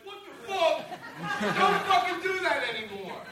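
high, mock road rage outburst; irritated driver. Angry driver to a car rushing the light, mock yell. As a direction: snap at the driver cutting in. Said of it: "you're like".

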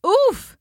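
high, startled oof; winded grunt as he bumps her. A narrator's reading. Mother mouse knocked by her son, startled oof. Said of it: "she said".

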